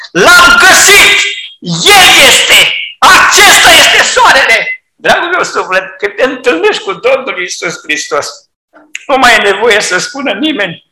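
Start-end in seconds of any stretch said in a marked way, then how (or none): 0.1-4.8 high, loud, firm declaration; completely sure, triumphant cry; sure and certain. Acted. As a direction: proclaim it with full certainty. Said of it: "Deplin, hotărât și sigur".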